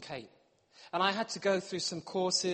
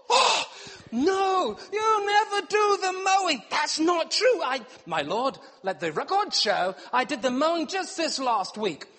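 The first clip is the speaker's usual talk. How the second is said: high, loud hammed-up defence; mock courtroom protest; 8 semitones higher, 6 dB louder than usual. Husband pleading to a judge over the mowing, high loud mock outrage. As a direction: plead your case to the judge.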